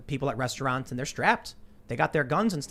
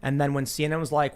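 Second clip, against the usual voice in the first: normal talking voice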